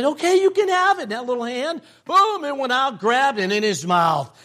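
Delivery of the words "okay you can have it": high, quick, excited release; mother giving permission. Retold or imitated. Mother finally allowing her son the cookie, high quick permission. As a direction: imitate a mom finally letting her child go ahead. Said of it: "And finally she said".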